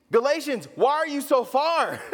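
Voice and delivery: high, loud plea to come closer; Paul calling the distant Galatians, high and loud. Retold or imitated. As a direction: call across the distance, pleading.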